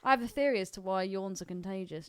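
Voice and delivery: normal talking voice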